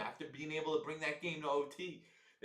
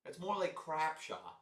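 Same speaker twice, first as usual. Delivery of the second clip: normal talking voice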